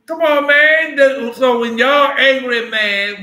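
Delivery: loud, slow preaching cry; preaching shout. Real, not acted. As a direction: throw it out to the whole room. Preacher raising voice to crowd.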